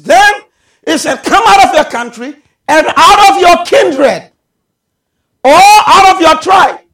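loud, high scripture proclamation; full-force preaching. Real, not acted. Preacher quoting God's call to Abraham, full-force preaching. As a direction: hurl the command out to the congregation.

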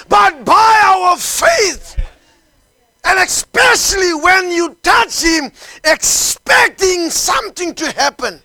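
high, impassioned revival sermon; full-force preaching. Real, not acted. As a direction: cry it out to the congregation, drawing out key words.

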